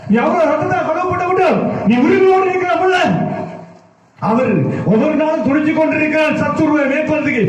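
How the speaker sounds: high, unrelenting preacher's chant-like drive; full-force preaching. Acted. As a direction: pile up the phrases with fervour, pitched high. (உச்சக் குரலில் ஆவேசமான பிரசங்கம்)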